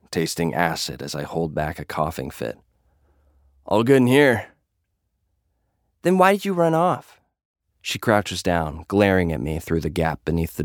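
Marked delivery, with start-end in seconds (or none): 3.7-4.5 covering up. Acted. Hiding sickness in stall. 6.0-7.2 high, sharp demand; Sweets demanding. Acted. Sweets confronts him.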